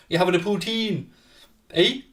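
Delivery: light mock Canadian lilt on the question; a playful hint of Canadian, rising on eh; Canadian accent. Teasing a Montreal viewer in a mild mock Canadian accent. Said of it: "That's my best Canada impression".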